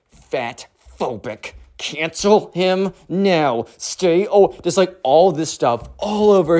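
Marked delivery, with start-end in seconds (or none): 0.1-4.3 very slow, word by word; ominous slow readout. Retold or imitated. Reading marker scrawled on Carter's locker, slowly and deliberately. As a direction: spell out each phrase deliberately. Reads writing on the locker aloud.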